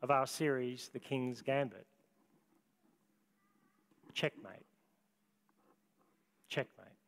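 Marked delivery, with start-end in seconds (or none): 4.1-7.0 low, soft, deliberate verdict; low final word. Acted. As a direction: say it quietly like a final move. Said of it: "utters the final words".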